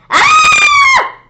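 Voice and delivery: high loud scream. Acted. Kids screaming at the Halloween monster, terrified. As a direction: let out a frightened shriek. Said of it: "We all screamed".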